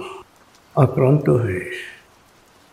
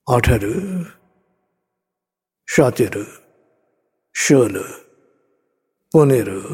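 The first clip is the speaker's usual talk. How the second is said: slow, soothing hypnotic countdown; guided meditation. Acted. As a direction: lead them down gently, step by step.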